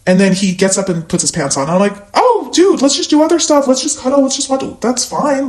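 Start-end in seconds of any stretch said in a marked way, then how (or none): 2.2-5.5 high, quick-to-reassure pleading; high coaxing. Retold or imitated. Woman re-enacting begging her date to stay, high coaxing. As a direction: coax him to stay in a raised pitch.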